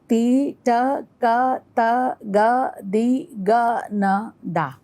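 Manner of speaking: "ti, ta, ka" slow, every syllable drawn out on its own beat; slow first tempo. Shown as a demonstration. Teacher naming the first lay, slow tempo. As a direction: recite one separate syllable per beat. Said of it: "First lay".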